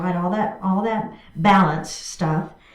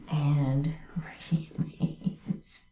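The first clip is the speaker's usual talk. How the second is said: slow whisper with a laugh; 8 semitones lower, 10 dB quieter, much slower than usual. Real, not acted. Instructor to class ending the pose, laughing whisper. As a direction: whisper the release, chuckling.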